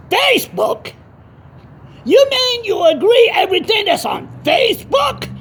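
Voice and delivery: incredulous high-pitched outburst. Retold or imitated. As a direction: shoot way up high in disbelief. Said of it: "gonna raise the voice a little bit".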